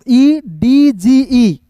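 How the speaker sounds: very slow, drawn-out letter names; slow spelling. Shown as a demonstration. Teacher spelling a word for learners, slowly letter by letter.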